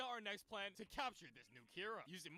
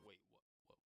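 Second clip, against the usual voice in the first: low startled muttering; 12 semitones lower, 18 dB quieter, much faster than usual. Acted. Higuchi realizing, low mutter.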